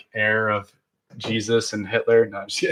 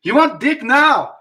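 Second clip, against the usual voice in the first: high, loud mock German accent, slowing at the end; 14 semitones higher, 5 dB louder, slower than usual. Friends trying a German accent, a loud mock German accent. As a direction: yell it in a hammed-up German accent. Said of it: "Just really loud".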